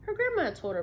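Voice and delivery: lively read-aloud for a class; storybook narration; story reading to children. Acted. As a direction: tell the story to young listeners. Teacher reads picture book aloud.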